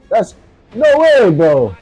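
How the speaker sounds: high, loud, drawn-out protest; outraged disbelief. Real, not acted. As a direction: cry out in disbelief at being killed. Gamer shot dead, protests in disbelief.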